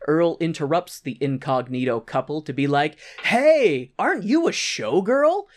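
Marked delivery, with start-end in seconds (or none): 3.2-5.4 loud, high-pitched mock hail; perky acted interruption; calling out. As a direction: butt in brightly on a couple. Earl interrupts the couple, acted.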